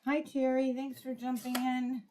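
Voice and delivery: lifted, happy hello to a live viewer; cheery greeting. Real, not acted. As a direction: greet a friend who just joined.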